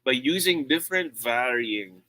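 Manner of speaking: ordinary talk, nothing marked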